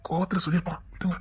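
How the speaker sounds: hushed, breathless aside; whisper. Acted. Relative whispering to the angry uncle to calm him. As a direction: whisper it so only he hears.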